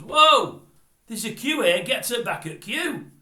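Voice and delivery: loud, high shout across a packed chip shop; angry shout from the back. Retold or imitated. Man at the back of the queue yells at a queue jumper, loud and high. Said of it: "a voice from the back goes".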